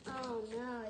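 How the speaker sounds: ordinary delivery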